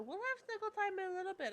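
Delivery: high, quick cooing promise. Real, not acted. Streamer promising her cat snuggle time in high baby talk.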